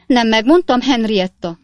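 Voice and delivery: a mother crying out, offended, to her daughter; indignant outcry. Acted. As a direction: cry out like a deeply offended mother. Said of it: "kiáltott fel Napkinsné".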